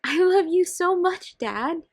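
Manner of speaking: high, loud gush of love. Acted. Daughter gushing at her dad, high and loud. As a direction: throw your arms round him with it.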